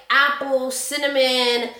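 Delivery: ordinary talk, nothing marked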